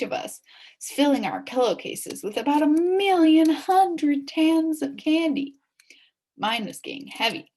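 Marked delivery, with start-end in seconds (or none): 2.2-5.5 drawn out, louder and higher as the pile grows; excited exaggeration. A narrator's reading. Narrator marvelling at the candy haul, excited.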